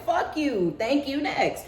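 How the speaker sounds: high, flippant dismissal; laughing brush-off. Retold or imitated. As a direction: toss it off through a laugh.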